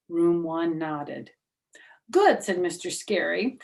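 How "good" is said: eager teacher. Acted.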